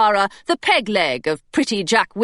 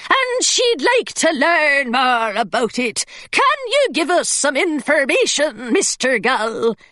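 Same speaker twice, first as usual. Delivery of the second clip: put-on higher, rough pirate accent, governess in disguise; thick pirate accent; 5 semitones higher than usual. A narrator's reading. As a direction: sell the pirate accent hard. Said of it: "in a thick pirate accent".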